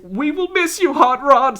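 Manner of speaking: hammed-up high fan farewell; high-pitched fan cry. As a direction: read the banner as a high mock wail. Reads out fans' sign in voice.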